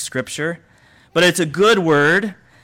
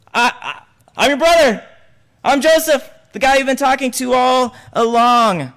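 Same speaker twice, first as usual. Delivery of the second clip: higher, emotional reveal as Joseph; Joseph revealing himself; 7 semitones higher than usual. Acted. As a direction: cry out the reveal to your brothers.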